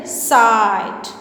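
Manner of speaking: साइट said slowly with a long drawn i; long i demo. Shown as a demonstration. Teacher showing students the magic E sound, slowly. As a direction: stretch the vowel in साइट. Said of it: "बस ये इसका sound change कर देता है".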